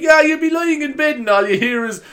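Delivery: high squeaky mock voice of a friend; mocking friend's voice. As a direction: mimic him in a silly high pitch. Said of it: "He's like".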